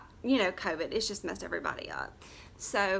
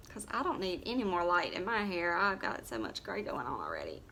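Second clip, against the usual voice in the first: normal talking voice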